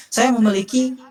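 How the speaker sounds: bright, lively salesman opening; upbeat sales pitch start; energetic pitch. Shown as a demonstration. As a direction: sell it with energy. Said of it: "Harus lebih energi".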